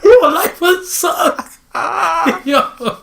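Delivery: high, drawn-out wheeze through laughter; helpless high-pitched laughing speech; laughing hard. Real, not acted. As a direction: barely get it out for laughing. Cracking up at the scenario.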